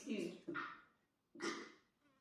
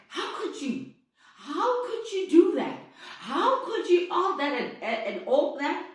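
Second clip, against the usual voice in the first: higher and louder, outraged; angry accusation; 5 semitones higher, 6 dB louder than usual. Retold or imitated. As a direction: hurl the questions in outrage. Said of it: "the man was like".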